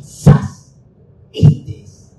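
breathy sudden aha; a whispered discovery; the girl grasps the game, whispered. Retold or imitated. Child's sudden realisation voiced.

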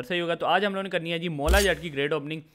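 ordinary talk, nothing marked

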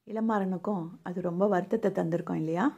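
ordinary talk, nothing marked

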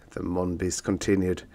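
ordinary delivery, nothing marked

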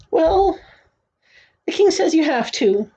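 put-on high boy's voice; young boy. A narrator's reading. Boy relaying the king's order, high young voice. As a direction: insist in a high child's voice. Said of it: "said Bartholomew".

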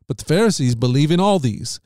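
ordinary delivery, nothing marked

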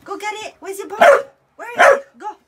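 very high, urging voice; excited dog talk. Real, not acted. Owner sending dog after its bone, high excited urging. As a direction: rile the dog up to go fetch.

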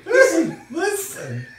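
loud high listen listen; shouted fairy call. Retold or imitated. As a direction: yell it like a tiny fairy. Navi impression shouting.

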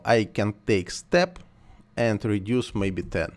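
ordinary talk, nothing marked

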